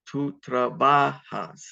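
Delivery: Tú trabajas slowly, with strong "ba"; second-last syllable stressed. Shown as a demonstration. Teacher demonstrating emphasis, slowly stressing trabajas on "ba". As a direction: hold the "ba" in trabajas. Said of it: "it goes on the second last syllable".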